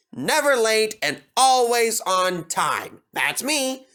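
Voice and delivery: lightly voiced vain young engine bragging; conceited engine James. A narrator's reading. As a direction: show off to little Toby.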